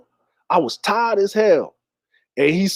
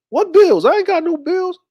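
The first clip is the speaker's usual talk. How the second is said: put-on high-pitched boyish protest; indignant protest; 11 semitones higher than usual. Son disputes mama's bills, high and indignant. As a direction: object like a kid being cheated, voice shot up. Boy protests mother's budgeting lesson.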